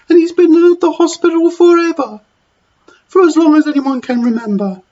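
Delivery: very high, wailing oh no; distressed dinner lady. Acted. Dinner lady upset the porter was sacked, high wail.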